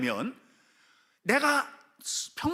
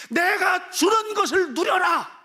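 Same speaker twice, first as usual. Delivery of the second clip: slow, high, loud proclamation; shouted divine command; 11 semitones higher, 8 dB louder, slower than usual. Acted. Preacher voicing God's command to enjoy His gift, commanding shout. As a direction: thunder it out as God's own order.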